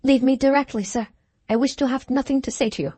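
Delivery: lightly voiced lady with a foreign accent, curt; foreign woman's voice. A narrator's reading. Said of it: "spoken in a foreign accent and in a woman's voice".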